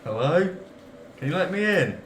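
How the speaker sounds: pleading stranger voice. Acted. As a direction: plead to be let in. Player voices survivor at door.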